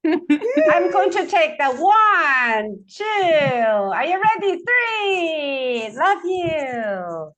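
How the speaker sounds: high, slow, drawn-out count; photo countdown. Real, not acted. Host counting for the last photo, high drawn-out calls. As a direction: stretch each call high and let it fall.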